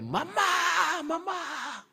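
put-on very high little-girl voice calling out; girl calling mother. María running to fetch her mother, high child's call. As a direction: call for mom like an eager young sister.

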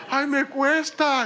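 high, slow, laughing through the words; laughing strain. Retold or imitated. Father laughing at his own effort to smile, high and slow. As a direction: laugh as if forcing a smile hurts.

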